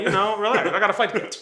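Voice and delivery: acting out telling mom to relax; retelling dismissive reassurance.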